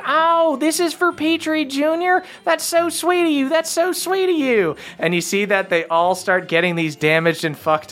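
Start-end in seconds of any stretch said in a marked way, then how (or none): 0.1-4.8 very high-pitched moved elf voice; touched crick elf. Acted. Elf receives gift for his child, high and touched. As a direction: gush thanks in a high elf voice. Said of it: "And he goes like".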